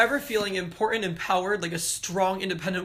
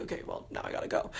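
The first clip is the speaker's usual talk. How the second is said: whispering; 11 dB quieter, much faster than usual. Retold or imitated. Retold reply to mom.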